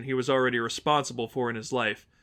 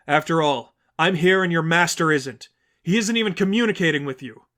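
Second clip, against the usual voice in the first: put-on higher, louder young woman pleading firmly; Stephanie, urgent denial; 5 semitones higher, 5 dB louder than usual. Stephanie denying to the congregation she is Mary, urgent. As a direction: insist you're no saint.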